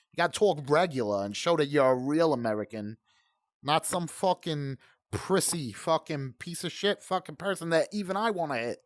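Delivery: normal talking voice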